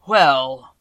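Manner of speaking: a vast, slow, thundering wizard; voice of the great Oz. A narrator's reading. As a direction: boom each word out like a huge magic head.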